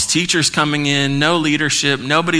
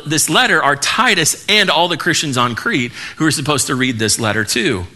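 ordinary talk, nothing marked

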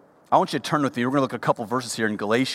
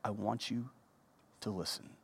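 much softer, held-back plea; Paul speaking gently to his spiritual children, very soft; softened voice; 16 dB quieter than usual. Retold or imitated. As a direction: drop to a hush to make them listen. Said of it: "he wants to change his voice".

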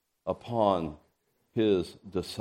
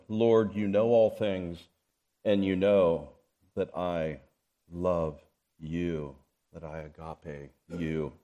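tender grief; 4 semitones lower, slower than usual. Acted. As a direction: let it fall to almost nothing. Peter grieved, tender reply.

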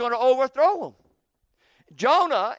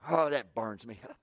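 grumbled aside; 11 semitones lower, 7 dB quieter than usual. Acted. Jonah's grumbling aside to God.

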